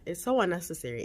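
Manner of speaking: ordinary delivery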